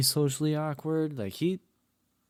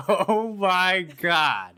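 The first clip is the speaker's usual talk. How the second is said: slow, drawn-out and higher, held back after a laugh; stunned oh my God; 8 semitones higher, 6 dB louder, much slower than usual. Retold or imitated. Coworkers holding back laughter at the cat guy, stretched and high. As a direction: stretch it out in disbelief. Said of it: "we're like".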